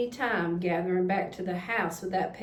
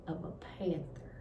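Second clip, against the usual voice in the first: whispered, much softer; hushed reveal; 4 semitones lower, 10 dB quieter, faster than usual. A narrator's reading. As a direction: drop to a spooky whisper. Ominous reveal of panther tracks.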